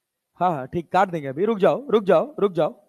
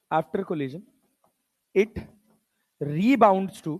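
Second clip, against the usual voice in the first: low, measured dictation with long gaps; quiet word-by-word dictating; 7 semitones lower, 5 dB quieter, slower than usual. Real, not acted. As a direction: read it out piece by piece for note-taking. Dictates the question in English.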